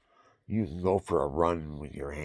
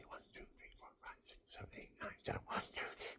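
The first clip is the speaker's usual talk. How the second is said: quick, barely voiced count; counting under breath; 19 dB quieter, much faster than usual. Real, not acted. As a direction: count in a whisper while exerting yourself. Counting under breath during hand exercise.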